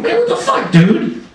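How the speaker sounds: high-pitched exasperated protest; mock indignant outburst. Losing poker player confronting cheating Satan, mock indignant. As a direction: jump up high in disbelief.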